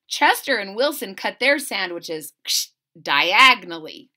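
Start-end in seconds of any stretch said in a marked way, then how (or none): none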